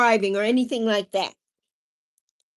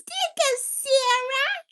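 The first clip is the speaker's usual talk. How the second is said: much higher and slow, lilting; high sing-song; 13 semitones higher, slower than usual. Real, not acted. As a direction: coo it to her fondly.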